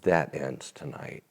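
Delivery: soft, low, earnest; quietly firm promise; retold declaration. Preacher telling the waitress her trouble ends, softly. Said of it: "And I said".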